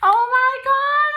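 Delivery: almost sing it out with joy; sung-out high squeal of delight; excited squeal. Real, not acted. Opening the gift.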